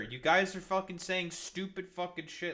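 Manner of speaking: ordinary talk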